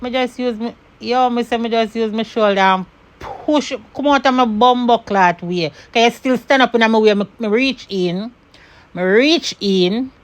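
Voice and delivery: loud, heated patois rant; angry Jamaican patois. Real, not acted. Telling how the neighbour blocked the door, furious patois. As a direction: let the rage burst through in Jamaican patois.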